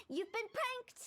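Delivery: spring the prank on him with glee; triumphant teasing gotcha; gleeful gotcha. Real, not acted.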